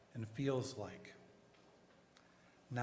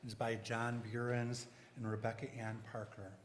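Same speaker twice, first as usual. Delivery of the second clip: usual speaking voice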